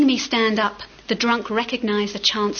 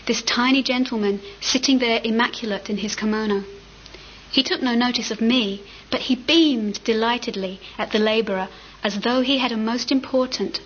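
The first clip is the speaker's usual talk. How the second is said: ordinary delivery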